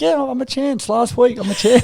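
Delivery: high, bright-eyed innocent claim; cheeky high-pitched insistence; mock cheerful reply. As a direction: play it high and naively hopeful. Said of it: "I'm like".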